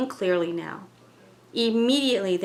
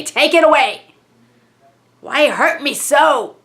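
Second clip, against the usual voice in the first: put-on a frightened entity crying out in Ethel's accent; distressed spirit, accented; 2 semitones higher, 10 dB louder, slower than usual. Spirit through medium Ethel begging to be left alone, loud and pained.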